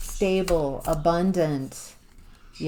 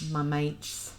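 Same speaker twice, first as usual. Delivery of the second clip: Australian accent; 6 dB quieter than usual. Real, not acted. Reader echoing her guide's words, Australian accent. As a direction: softly try out an Australian accent. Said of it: "an Australian accent just came in".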